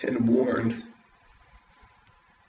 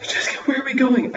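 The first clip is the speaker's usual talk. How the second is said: put-on scared little boy; 12 semitones higher, 5 dB louder, faster than usual. A narrator's reading. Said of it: "scared boy asks his sister".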